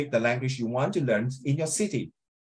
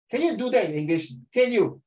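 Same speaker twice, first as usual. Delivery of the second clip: ordinary delivery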